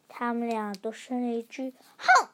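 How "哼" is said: a high, loud sulky huff; angry humph. Acted. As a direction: huff at each other. Both kids huff together.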